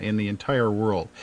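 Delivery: ordinary talk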